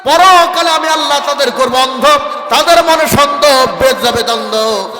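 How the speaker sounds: forceful, driving sermon delivery; preaching. Acted. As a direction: preach it loud to the crowd, drawing out the line endings. (জোরালো ওয়াজের সুর)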